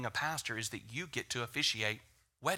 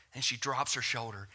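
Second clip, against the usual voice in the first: usual speaking voice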